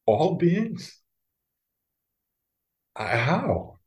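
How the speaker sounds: incredulous, rising questioning lilt; mildly acted self-doubt. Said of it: "doubt says".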